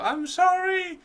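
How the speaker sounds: a whiny drawn-out sorry; hammed-up high apology; mock squeaky apology.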